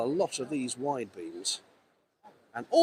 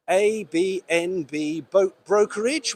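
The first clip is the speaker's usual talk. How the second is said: usual speaking voice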